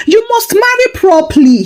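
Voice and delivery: insistent aunt, pitched high; insisting aunts. Acted. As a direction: press him like a nagging aunt. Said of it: "his aunts insisted".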